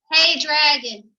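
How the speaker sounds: a whisper that comes out loud; fake whisper. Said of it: "That wasn't a whisper".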